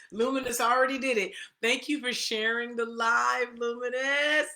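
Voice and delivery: loud laugh through the words; laughing out loud with delight. Real, not acted. Laughs at a viewer's share.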